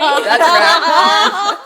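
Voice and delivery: loud, high laugh into the words. Real, not acted. Guest laughing after the frying-pan story, loud.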